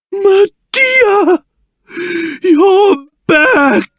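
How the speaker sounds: high-pitched, delighted calling out; joyful reunion. Acted. King sees Medea back, overjoyed. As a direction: cry out to a beloved one just restored.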